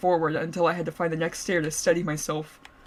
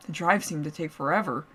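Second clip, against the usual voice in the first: usual speaking voice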